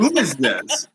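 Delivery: high, laughing mock bewilderment; baffled fans. Host playing everyone meeting the new character, comically baffled. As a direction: imitate viewers thrown by a stranger. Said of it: "everyone's like".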